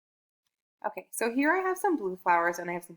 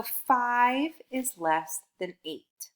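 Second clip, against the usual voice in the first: slow, word by word answer; slow model answer; 3 semitones higher, much slower than usual. Shown as a demonstration. Teacher modelling the answer for a child, slow and clear. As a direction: model the answer sentence slowly. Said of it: "which one is the smaller number".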